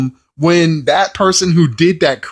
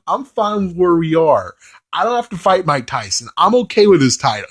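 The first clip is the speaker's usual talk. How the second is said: ordinary talk, nothing marked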